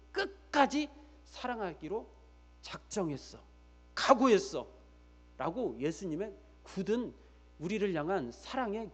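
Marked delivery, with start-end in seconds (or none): none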